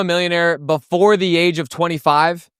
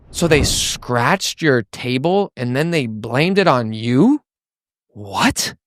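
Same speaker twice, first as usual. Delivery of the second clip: disgusted shock; 3 semitones lower, slower than usual. Shown as a demonstration. Salesman reacting to a prospect's bad mover story, shocked. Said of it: "rate of speech is kinda, like, slow to medium".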